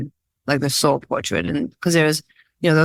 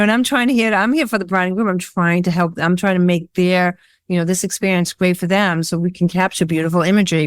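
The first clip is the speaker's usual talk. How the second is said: normal talking voice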